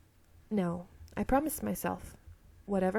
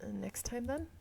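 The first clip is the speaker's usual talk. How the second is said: mouthed softly; 3 semitones higher, 7 dB quieter, slower than usual. Acted. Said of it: "He mouths".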